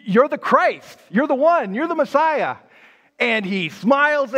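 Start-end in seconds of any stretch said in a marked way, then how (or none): 0.0-2.6 higher, eager declaration; thrilled at getting the answer; excited. Retold or imitated. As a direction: blurt out the revelation with rising excitement. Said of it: "And you are excited".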